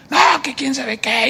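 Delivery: mock whining retort, slow; a hint of a sulking brother; whiny brother. (hermano quejumbroso, imitado)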